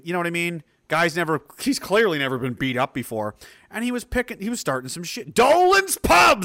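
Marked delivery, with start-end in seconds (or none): 5.3-6.4 high, drawn-out shout; excited shout. Real, not acted. Recognizing the pub someone named, excited shout. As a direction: bellow it slowly and high.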